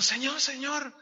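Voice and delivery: put-on little girl calling. Girl repeats her call to the preacher, high and eager. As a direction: call him again like a small girl.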